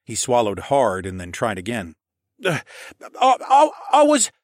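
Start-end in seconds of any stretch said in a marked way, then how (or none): 2.4-4.3 high, loud startled stammer; stammering boy. Acted. Startled stable boy stammers at Alex, high and halting. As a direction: trip over every I. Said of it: "It wasn't really any better".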